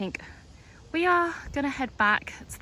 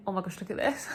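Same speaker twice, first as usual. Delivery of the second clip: laughing while talking; words through a real laugh. Real, not acted. Laughs at something she sees.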